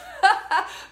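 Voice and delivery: bright laugh at her own joke; burst of laughter. Real, not acted. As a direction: laugh out loud, amused.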